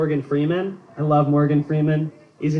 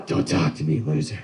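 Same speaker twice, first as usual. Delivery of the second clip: much lower, mean grumbled brush-off; Clint Eastwood growl; 11 semitones lower than usual. Retold or imitated. Grandpa Eastwood snubbing his grandkid, deep growl. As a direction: snarl it at the grandkid. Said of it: "He's just very angry and mean".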